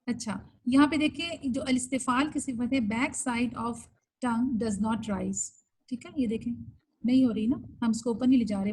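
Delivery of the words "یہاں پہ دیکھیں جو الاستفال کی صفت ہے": ordinary talk, nothing marked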